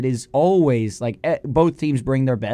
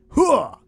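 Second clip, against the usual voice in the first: gung-ho grunt; 12 semitones higher than usual. Acted. Host's hype shout into segment.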